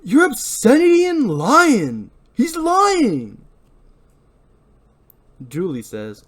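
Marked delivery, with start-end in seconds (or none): 0.0-3.4 very high, loud and slow ranting; furious accusation. Acted. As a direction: rage at him, drawing the words out. Barry accuses Ray.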